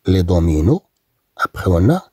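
ordinary talk

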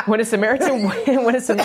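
giggling over the word Samaritan; laughing. Real, not acted. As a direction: try to read but keep cracking up. Laughing fit while reading scripture.

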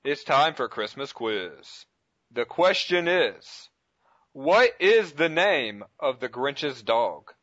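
slow, dramatic quiz question; game show host. Acted. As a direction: build suspense like a quiz host.

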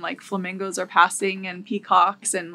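normal talking voice

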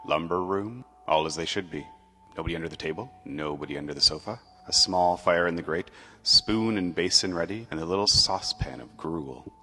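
usual speaking voice

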